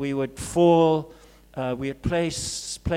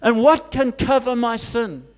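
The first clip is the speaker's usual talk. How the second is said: ordinary delivery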